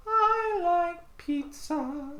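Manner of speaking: very high, slow owl voice; Mister Owl voice. Shown as a demonstration. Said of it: "your Mister Owl voice".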